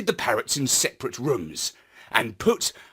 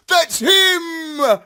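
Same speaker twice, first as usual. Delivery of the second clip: much higher, loud triumphant cry; excited shout; 13 semitones higher, 6 dB louder than usual. A narrator's reading. Astropup telling robo-dogs he's found the real parrot, high excited shout. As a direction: shout it out, thrilled to find him.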